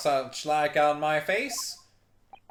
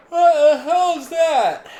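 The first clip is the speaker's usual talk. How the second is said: high, loud startled outburst; 12 semitones higher, 14 dB louder, slower than usual. Real, not acted. Player reacting to a strange noise, loud and startled.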